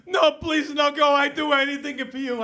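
high, loud hammed-up begging; desperate pleading mother. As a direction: wail it like a mom clinging on. Mother begs son not to leave.

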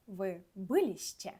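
"Ви биліще" slow, stress on "лі" of "биліще"; slow stressed Polish form. Shown as a demonstration. Teacher modelling the stress for students, slowly. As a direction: say "Ви биліще" slowly, stress third syllable from the end. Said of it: "наголос падає на третій склад з кінця".

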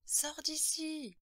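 put-on high, drawn-out child's cry; hysterical little girl. A narrator's reading. Possessed girl orders someone out, hysterical high voice. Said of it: "la petite voix d'Heather s'éleva".

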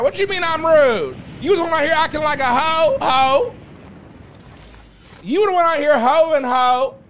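high, loud teasing call-out; playful loud clapback. Real, not acted. Streamer loudly teases a viewer who called her rude. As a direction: yell it back at chat in fun. Playful mock argument with chat.

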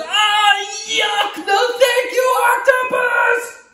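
very high, loud shouted yuck; disgusted boy. Acted. Boy refusing painted teeth, high shout. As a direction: shout it grossed out. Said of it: "And the boy says".

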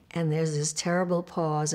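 ordinary talk, nothing marked

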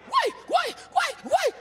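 very high imitated keening; hammed-up funeral wailing, rising and falling; mock mourner wail. Mourners fainting at a wake.

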